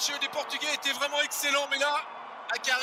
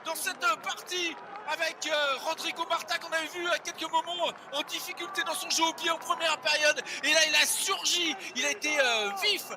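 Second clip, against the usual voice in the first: fast live rugby play-by-play; live sports caster; 3 semitones higher than usual. Real, not acted. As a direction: narrate the action as it unfolds. (commentateur sportif en direct)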